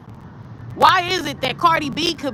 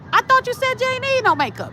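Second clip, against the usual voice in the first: much higher, sneering mimic of a hater; mocking quote; 12 semitones higher, faster than usual. To the hater who now wants her makeup done, high mocking mimic. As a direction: throw their own words back at them, mockingly.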